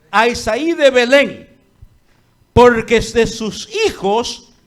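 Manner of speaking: God commanding loudly. Acted. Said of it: "Y Dios dice".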